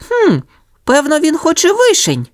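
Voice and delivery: very high musing puppy voice; clever little dog. A narrator's reading. Sonya guesses the passer-by wants cherries, high voice. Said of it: "здогадалася розумна Соня".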